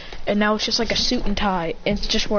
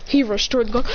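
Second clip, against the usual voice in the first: ordinary delivery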